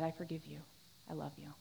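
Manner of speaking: soft, low and gentle; a parent quietly forgiving their child; tender forgiveness. Retold or imitated.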